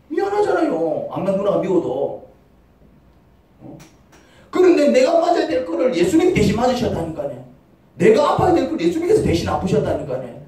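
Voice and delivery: ordinary delivery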